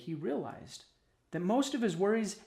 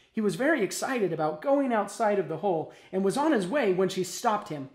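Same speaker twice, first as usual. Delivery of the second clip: ordinary delivery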